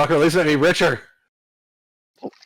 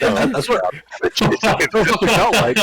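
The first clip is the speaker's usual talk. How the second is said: high, loud laugh through the words; 9 semitones higher, 5 dB louder than usual. Real, not acted. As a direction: crack up while agreeing. Laughing agreement at the joke.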